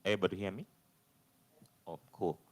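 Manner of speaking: normal talking voice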